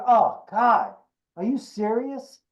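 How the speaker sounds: can't-believe-this director, fed up; exasperated director. Retold or imitated. Director exasperated at clueless auditioner.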